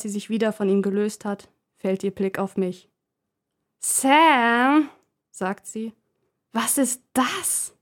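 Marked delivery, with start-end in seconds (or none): 3.8-4.9 put-on shrill girlfriend. Leonie ruft gereizt nach Sam. 6.5-7.8 put-on disdainful girlfriend. Leonie fragt abfällig nach Puppe.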